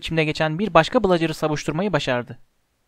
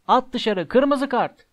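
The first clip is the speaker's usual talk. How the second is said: shouting; 6 semitones higher, much slower than usual. A narrator's reading. Dean in the stands demanding a red card, restrained yell. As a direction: cry out from the stands, held back in volume. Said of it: "avazı çıktığı kadar bağırıyordu".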